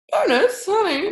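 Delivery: excited squeal. Real, not acted. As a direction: perk right up at the word bonus. Sales pitch, sudden excitement.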